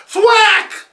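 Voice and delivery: high, loud comic-book hit; loud sound effect. Acted. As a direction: punch out the sound.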